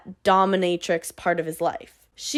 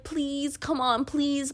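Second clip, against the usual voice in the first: softer, whiny mock begging; pleading; 4 semitones higher, 6 dB quieter, slower than usual. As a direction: beg her, hamming up the whine. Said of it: "pleading with her".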